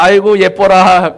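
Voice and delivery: a playful pleased exclamation in the mirror; delighted admiring. Retold or imitated. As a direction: coo at your own reflection. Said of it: "그럴 때마다".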